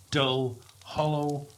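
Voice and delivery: plain reading voice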